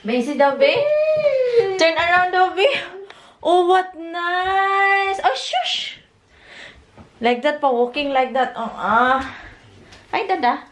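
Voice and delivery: high, slow sing-song cooing. Real, not acted. Baby talk to toddler.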